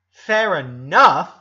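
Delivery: loud, slow, incredulous echo; disbelief. A narrator's reading. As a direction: throw his words back at him, stunned. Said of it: "not believing what he just heard".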